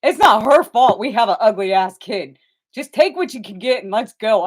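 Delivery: loud, exasperated dad voice. Retold or imitated. The dad tells the mom to just take the photos, loud and exasperated. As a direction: lay down the law at his wife. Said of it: "he goes".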